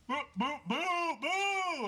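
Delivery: high mock booing from the crowd. Crowd boos the wrestlers for hugging, jeering high. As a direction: boo them like a disappointed crowd.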